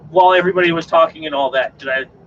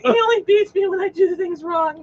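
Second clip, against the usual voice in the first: squeaky mock victim; high falsetto voice. Mock abused-spouse joke.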